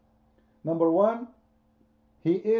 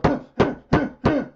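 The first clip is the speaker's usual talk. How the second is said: mocking laugh; 10 semitones higher, 6 dB louder than usual. Mock voice laughing.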